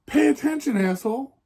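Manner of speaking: high, scolding yell at the careless driver; angry yell. Real, not acted. Telling the driver who dented the car, angry high yell. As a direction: snap it at the driver like he can hear you.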